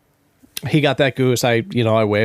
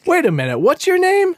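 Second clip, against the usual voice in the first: very high called-out question; retold yelling. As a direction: holler the question across the water. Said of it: "seventy-five yards away yelling".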